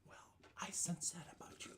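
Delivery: breathy secret whisper. Acted. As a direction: whisper it close. GM voicing NPC whisper.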